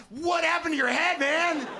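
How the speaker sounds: very high, loud comic yell; shocked outburst. Retold or imitated. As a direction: blurt it out in mock shock, pitch way up. Acted first thought on seeing scar.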